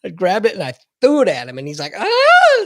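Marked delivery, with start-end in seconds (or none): 1.9-2.6 a sudden high shrill yelp; high scream. Retold or imitated. The drunk kid hit by the wall locker, high yelp. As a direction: yelp like you just got hit. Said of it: "screamed like a bitch".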